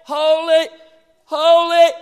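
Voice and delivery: high shouting holies; loud worshipful cry. Retold or imitated. Seraphim flying around the throne, loud cry. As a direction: shout it out like angels. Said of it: "flying around the throne going".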